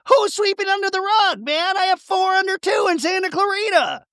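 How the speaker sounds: very high, whiny put-on man's voice; mocking Danny voice. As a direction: squeak it out like a flustered dad. Danny quoted in recap.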